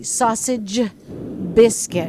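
sausage with a clear final G sound before biscuit; finish the G. Shown as a demonstration. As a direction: land the end of sausage, then biscuit. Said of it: "it's the finishing the G".

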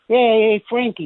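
high New York wise-guy call; New York character. Shown as a demonstration. Voice actor showing a partial impression, a New York guy hailing a pal. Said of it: "You can just kinda go".

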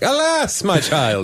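British theatrical alas, high swooping; hammed-up British epic-film voice; British Bible actor. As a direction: ham it up like an English stage actor. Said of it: "British people would be playing the Bible characters".

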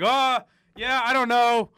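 ordinary talk, nothing marked

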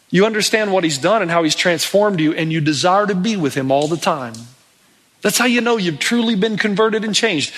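ordinary delivery

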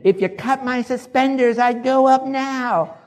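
high, loud, stretched-out whoop; excited, imitated. Imitating mentor eager for heaven, exuberant high shout. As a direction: crow it like an eager old saint. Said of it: "he was so excited about going to heaven".

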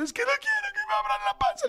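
berrinche agudísimo de niño, fingido; llanto infantil imitado. Retold or imitated. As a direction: chilla imitando a un niño que llora. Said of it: "sí me está llorando". (niño llorando a chillidos, imitado)

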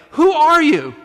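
high, loud challenging "Who are you?"; indignant demand. Retold or imitated. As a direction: fling the question back at him, outraged.

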